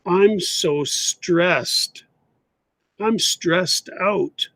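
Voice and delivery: very slow, clear teacher model; slow correct version; slow model sentence. Shown as a demonstration. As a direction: lay out each word slowly for learners. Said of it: "we should say".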